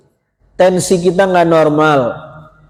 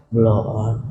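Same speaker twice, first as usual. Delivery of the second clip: "Blow on" repeated lower and softer, toned down; 10 semitones lower, 6 dB quieter than usual. Real, not acted. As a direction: say "Blow on" gently this time. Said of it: "Ya, saya pelanin". ("Blow on" diulang lebih pelan dan lembut)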